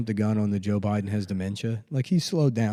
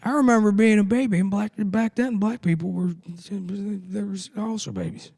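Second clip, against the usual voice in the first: put-on high, louder rambling old-man voice; Joe Biden; 10 semitones higher, 3 dB louder than usual. Mock president remembering being a baby, muddled old-man voice. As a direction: reminisce and lose the thread, hammed-up elderly impression.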